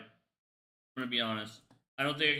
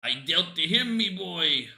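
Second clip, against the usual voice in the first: slow, louder gruff crab-captain voice; sea-dog cartoon boss complaining about a debt; Mister Krabs; 3 semitones higher, 5 dB louder, slower than usual. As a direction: ham up the greedy crab boss. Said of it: "Mister Krabs got scammed".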